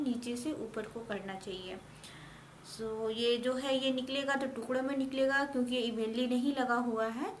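stiff lips under dried mask; talking while peeling face mask. Real, not acted.

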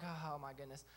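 low, soft sinking groan of words; deflated dismay. Retold or imitated. Husband retelling the empty radar, quiet low dismay. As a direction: let it sink quietly, as if beaten.

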